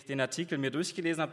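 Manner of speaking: ordinary delivery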